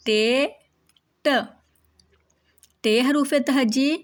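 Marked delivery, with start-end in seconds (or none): 1.3-1.3 a short, crisp "ट" sound, said on its own; isolated letter sound. Shown as a demonstration. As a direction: tap out "ट" on its own for the class. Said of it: "ते की sound है ट". ("ट" की साफ़ अलग आवाज़)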